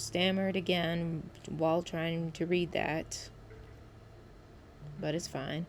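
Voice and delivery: usual speaking voice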